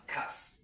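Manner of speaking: "cas" set apart as one syllable; isolated syllable. Shown as a demonstration. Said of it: "we have three syllables here".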